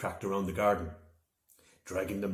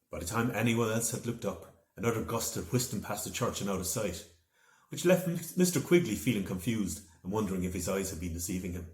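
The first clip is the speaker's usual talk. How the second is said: usual speaking voice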